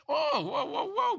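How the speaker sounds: sudden high alarmed whoa; real startled yelp. Real, not acted. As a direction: react as the snake suddenly thrashes. Startled snake thrashing in hand.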